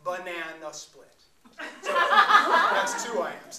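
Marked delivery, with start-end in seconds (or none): 1.8-3.6 much higher and louder, drawn out through laughter; real laughing delivery. Real, not acted. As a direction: laugh the words out. Laughing at own example.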